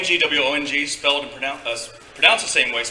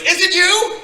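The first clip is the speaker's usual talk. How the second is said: very high, loud squeal of recognition; excited fan; 10 dB louder, slower than usual. Retold or imitated. Grinning kid recognizing an actor in a club, excited high yell. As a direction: shriek it in star-struck disbelief. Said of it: "And he goes".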